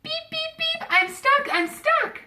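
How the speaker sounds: high, loud stuck cries; little truck crying out stuck; panicked beeping. A narrator's reading. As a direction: plead for help, alarmed. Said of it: "cried the Little Blue Truck".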